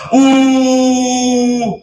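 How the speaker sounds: high, hooting mock horn call; horn blast imitation. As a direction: sound off like a ram's horn. Imita el cuerno de carnero. (imitación aguda del sonido de un cuerno)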